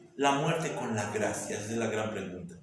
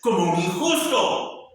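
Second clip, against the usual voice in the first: high, loud preaching that builds to a shout; fervent preaching; 9 semitones higher, 5 dB louder than usual. Acted. Preacher to his congregation about Christ the just, loud fervent preaching. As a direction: preach it up to a cry.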